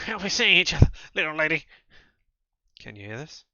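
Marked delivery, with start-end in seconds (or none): 0.1-1.6 loud, high, taunting goodbye; menacing farewell. Acted. Sheriff's ally threatening Julia. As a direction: send her off with a threat.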